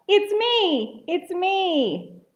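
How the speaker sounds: high, sing-out reveal; gleeful duck. A narrator's reading. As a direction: announce yourself in a high little voice. Voices duck revealing itself.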